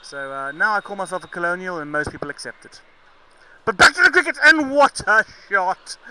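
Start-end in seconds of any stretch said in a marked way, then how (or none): 3.6-6.0 higher, louder, slowing on the shot; excited shout. Real, not acted. Commentator snapping back to the match, loud and high. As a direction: exclaim at a great shot.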